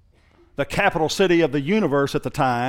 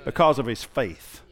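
rising, louder preacher build; preaching; 6 semitones higher, 3 dB louder than usual. Acted.

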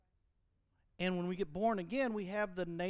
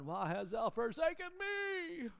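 anguished cry of Jesus; 8 semitones higher, slower than usual. Acted. Quoting Jesus on the cross.

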